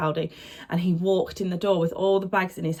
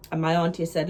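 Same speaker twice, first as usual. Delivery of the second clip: ordinary delivery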